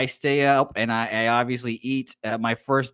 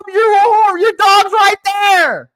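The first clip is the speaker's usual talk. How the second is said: higher, louder play-by-play in a goofy voice; high-pitched race caller; 10 semitones higher, 7 dB louder than usual. Acted. Host calls the dog race in the fan's high voice. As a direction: call the race like an overexcited cartoon announcer.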